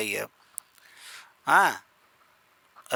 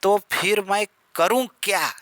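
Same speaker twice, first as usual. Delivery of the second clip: higher, exasperated line with क्या pressed; stress on क्या; 4 semitones higher than usual. Shown as a demonstration. Teacher acting exasperation for students, क्या stressed. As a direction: throw the weight onto क्या. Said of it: "इस पे क्या पे बल दे रहा है".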